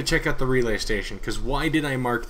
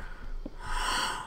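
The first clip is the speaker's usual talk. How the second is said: gasp; 10 dB quieter than usual. Real, not acted. Finds iron and a beacon.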